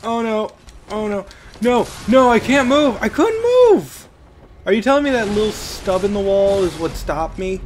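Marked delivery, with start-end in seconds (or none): 0.1-4.1 rising panic, pitch shooting higher and louder into a yelp; real gamer panic as the character gets stuck; panicked cry. Real, not acted. Player stuck on a wall mid-fight, panicked yelp. Stuck in game, enemy attacking.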